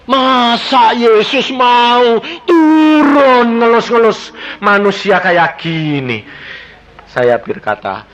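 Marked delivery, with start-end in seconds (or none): 0.1-6.2 slow, voice breaking with tears; slow, tearful wonder. Real, not acted. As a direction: let the tears come through slowly. Moved by Jesus' tenderness.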